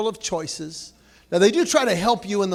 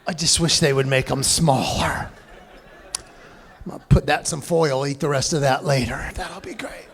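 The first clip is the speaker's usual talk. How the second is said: lower, mocking voice of a snacker who can't finish; mock lazy snacker; 5 semitones lower than usual. Mocking a snacker who wants smaller candy. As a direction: lightly play a lazy M&M eater. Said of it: "eating regular M and M's going".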